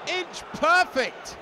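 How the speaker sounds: excited goal call. Real, not acted. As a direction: shout it with delight. Goal call in live commentary.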